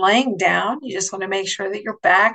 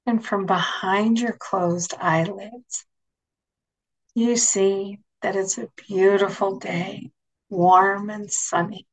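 guided meditation. Acted. Leads a guided imagery meditation.

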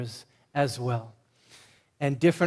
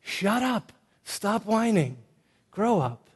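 higher, sharp drill-sergeant barks; stern commands; 6 semitones higher, slower than usual. Shown as a demonstration. Pastor rousing passive men, sharp and commanding. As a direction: snap orders at lazy men. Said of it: "we emphasize the ups".

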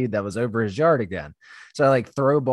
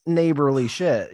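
usual speaking voice

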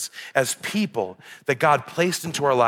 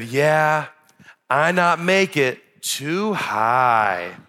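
put-on slow, drawn-out toddler admission; two-year-old impression; 2 semitones higher, much slower than usual. Two-year-old admits missed shot to dad, imitated toddler voice. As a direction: imitate a little boy, stretching each phrase. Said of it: "impression of my two-year-old".

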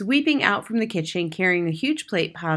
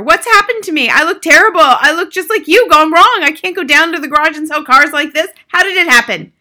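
high, very loud horrified outburst; father yelling; 9 semitones higher, 13 dB louder than usual. A narrator's reading. Father raves at his ruined hair, yelling. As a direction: yell in panic at your reflection. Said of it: "the father yelled".

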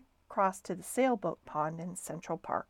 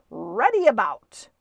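loud, drawn-up sailor's call; calling out a command; 9 dB louder than usual. A narrator's reading. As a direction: call the order across the deck.

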